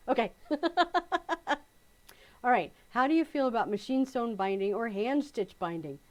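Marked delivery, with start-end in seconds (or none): 0.4-1.6 high, quick chuckling; real laughter. Real, not acted. Laughing off her accent attempt, real laughter. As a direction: giggle it off.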